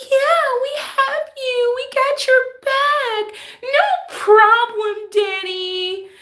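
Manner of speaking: very high, slow, syrupy falsetto; fake sweet caseworker. Mocking an evil caseworker's fake kindness, high and slow. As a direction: gush like a phony helper, over-sweet. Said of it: "But they talk like this".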